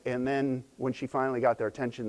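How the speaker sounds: usual speaking voice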